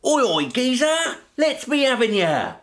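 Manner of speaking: loud, rough cockney geezer voice. Pretend. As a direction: shout it like a London geezer. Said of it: "Like that".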